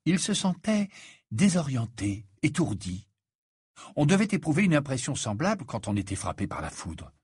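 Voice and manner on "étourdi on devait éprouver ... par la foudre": ordinary delivery, nothing marked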